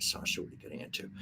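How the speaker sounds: quick, hushed whisper to the dog; whispered aside. Real, not acted. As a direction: mutter it fast under your breath. Aside to dog behind her.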